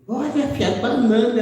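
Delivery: light up like the father seeing bananas; delighted father. Retold or imitated.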